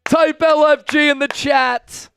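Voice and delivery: rally the viewers; hyped call. Real, not acted. Streamer firing up his chat after the recovery, hyped.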